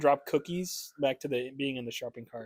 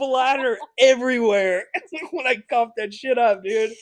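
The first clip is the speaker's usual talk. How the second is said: loud, high laughing; cracking up, higher and louder; 9 semitones higher, 10 dB louder than usual. Real, not acted. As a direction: laugh through the gross part. Laughing at own story.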